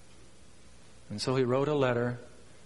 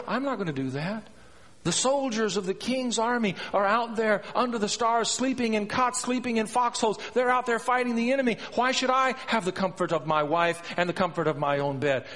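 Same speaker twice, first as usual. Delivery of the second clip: lightly acted soldier, pitched higher; loyal soldier protesting; 8 semitones higher than usual. Uriah refusing the king's offer of home comforts, protesting. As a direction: protest on principle, mostly higher. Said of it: "Because Uriah said".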